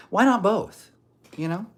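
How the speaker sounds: high, teasing why-not; cheeky quip. Acted. Streamer teasing a chatter with a cheeky answer. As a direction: toss it off playfully.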